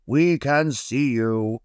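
mildly called-out warning from a big brush; crying out. A narrator's reading. Big brush has spotted the little paintbrush, calling out slowly. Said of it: "cried one of the big brushes".

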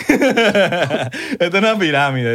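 slow, laughing sales pitch parody; mock the seller through laughter.

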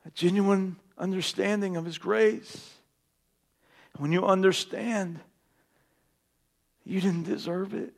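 soft, low, tender sermon voice; quiet intimate sermon; hushed preaching. Acted. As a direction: bring it down to a near hush.

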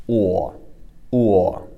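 "o" as a very froggy u-o glide; maximum frogginess. Shown as a demonstration. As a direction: make "o" as froggy as possible, u running into o. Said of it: "maximum frogginess on this".

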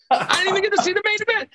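high, fast whiny voice; whiny mock complaint. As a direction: whine it like a sulky kid. Exaggerated repeat of complaint.

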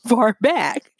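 breaking into a laugh mid-phrase; amused, losing composure. Real, not acted. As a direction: try to explain while laughter gets in the way. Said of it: "I'm laughing because".

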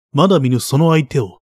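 ordinary delivery, nothing marked

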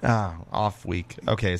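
ordinary talk, nothing marked

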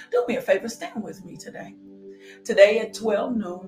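ordinary talk, nothing marked

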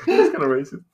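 high laughing protest. Real, not acted. Laughing at friend's joke.

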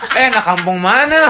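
loud, slow, high laughing jab; laughing tease. Real, not acted. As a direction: tease him through laughter. Teasing, laughing. (ejekan sambil tertawa)

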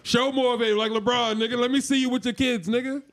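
high-pitched mock scolding of a friend; playful, high-pitched heckle; joking taunt. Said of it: "I know, I'm joking".

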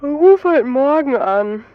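slow words stretched through a yawn. A narrator's reading. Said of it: "Christoph gähnt zurück". (gähnend und langsam gesprochen)

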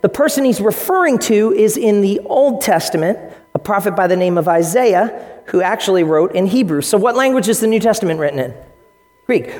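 ordinary delivery

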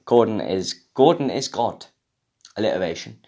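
usual speaking voice

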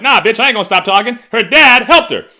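high, loud, fast defiant shout; mock defiant yell. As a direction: shout it back, refusing to back down. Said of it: "I'm like".

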